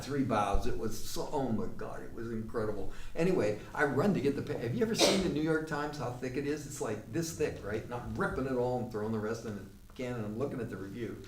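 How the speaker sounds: usual speaking voice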